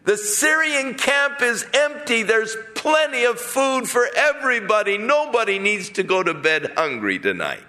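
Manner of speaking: calling up. Acted. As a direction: call up to the guard above. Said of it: "called up on the wall".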